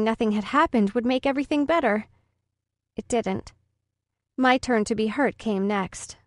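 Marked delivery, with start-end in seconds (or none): none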